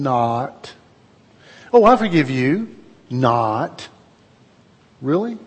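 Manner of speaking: mock forgiving then sneering Not; sarcastic forgiveness. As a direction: fake forgiveness, snatched back each time. Sarcastic fake forgiveness mocked.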